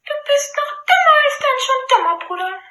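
high, smiling princess scorn; mock him with a smile; smiling mockery. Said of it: "antwortet die Prinzessin lächelnd". (lächelnd spöttisch)

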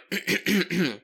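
loud, showy throat clear; preparatory throat clearing. Acted. Readying his London voice, showy throat clearing. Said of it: "Let's get my London voice ready".

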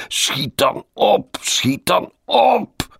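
very high, slow, drawn-out shriek; desperate scream. A narrator's reading. Colleague in despair at his late passenger, screaming. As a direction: wail it loudly, stretching every word.

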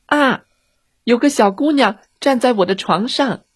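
usual speaking voice

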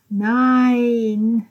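held-back wail of refusal; understated scream. A narrator's reading. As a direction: stretch the word out until the breath goes. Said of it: "schrie sie, bis sie keine Luft mehr hatte".

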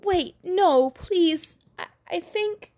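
high, desperate pleading woman; beg, fading to a whisper. A narrator's reading.

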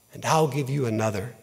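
soft and low, a quiet kindness; gently. A narrator's reading. Rag man gently offering the weeping woman a clean cloth, soft and low. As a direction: ease it to her softly like comfort. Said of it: "he said gently".

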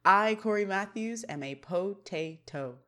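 slow, grave mock confession; mock-solemn Corey. Corey admits he is a potato, mock-solemn. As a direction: sink slowly into solemn resignation, word by word. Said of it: "but Corey's like".

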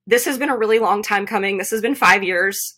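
ordinary talk, nothing marked

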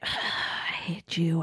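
slow, soft whispered sigh of hate; whispered mock loathing. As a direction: act out sulky loathing under the breath. Heroine's bitter sulk, acted.

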